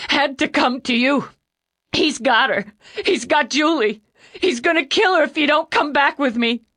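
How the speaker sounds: put-on panicked young man crying hard; hiccuping tears. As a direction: plead through loud hiccuping sobs. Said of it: "He burst into tears".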